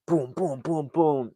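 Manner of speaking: rhythmic "boom" sound effect; imitated crashing booms. Narrator mimics van flipping six times, imitated booms. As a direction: mimic each thud of a rolling van.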